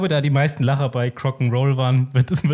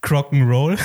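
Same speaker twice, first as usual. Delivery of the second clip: louder, presenting the joke title; proud pun reveal; 2 semitones higher, 6 dB louder than usual. Real, not acted. Host presents pun title to co-hosts, proudly. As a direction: announce it like a punchline. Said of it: "Nummer eins ist".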